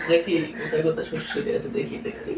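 hushed, sneaky teasing; creeping whisper. Pretend. Creeping tickle game.

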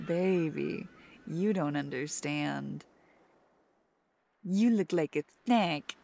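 ordinary delivery

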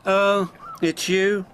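high, slow, disdainful greeting; haughty. A narrator's reading. Elizabeth meeting the Fat Controller, haughty. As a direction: look down on them, drawling high.

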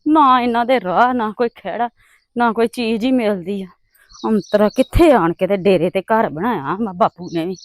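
low, hushed complaint to oneself; muttered grumbling. Acted. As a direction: grumble under your breath, softly and low. Grumbling alone while trudging along.

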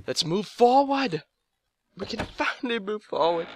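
high, relieved cheer; excited. Real, not acted. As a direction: celebrate finally getting unstuck. Relief at progress.

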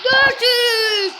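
high, screaming goal call; screaming football commentator. Real, not acted. Late goal, screaming celebration.

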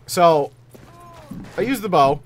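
ordinary talk

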